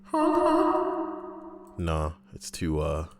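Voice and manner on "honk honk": high clown honk in echo; squeaky honk ringing out; clown honk with reverb. Pretend. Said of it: "Listen to that".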